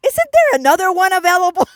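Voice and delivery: mock pleading with God, high and loud; comic plea. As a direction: beg God to pick someone else, half-laughing.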